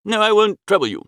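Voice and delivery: plain reading voice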